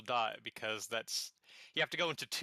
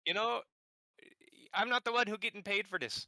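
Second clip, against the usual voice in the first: put-on Irish accent; 5 semitones higher than usual. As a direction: keep the hammy Irish brogue going. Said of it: "Nightmare Irish".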